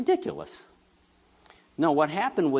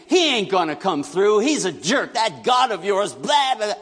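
mock taunt; 8 semitones higher, 5 dB louder than usual. Mocking God, blasphemer's taunt.

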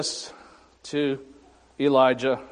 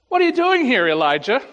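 God's question; 7 semitones higher, 3 dB louder, faster than usual. Acted. God questions Elijah in scripture.

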